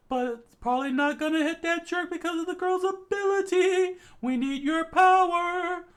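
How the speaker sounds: very high, loud, fast-paced plan; high urgent explaining. Acted. Andy explaining the plan to Chikara, high loud urgent voice.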